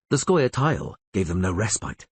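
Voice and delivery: plain reading voice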